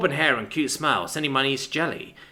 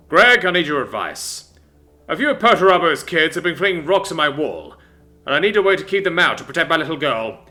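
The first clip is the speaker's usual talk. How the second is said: booming father voice; 4 semitones higher, 7 dB louder than usual. Acted. Father asks advice.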